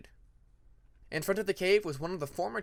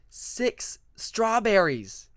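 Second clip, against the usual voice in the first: slow, louder, higher delight; excited; 5 semitones higher, 6 dB louder, much slower than usual. Acted. Hungry Peacemaker spotting the strawberries, slow excited exclaim. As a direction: savour the surprise slowly.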